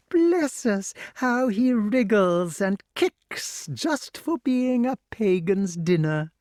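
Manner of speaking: high, slow cackling hag; mock his struggling; mocking witch. A narrator's reading. Witch mocks the boy.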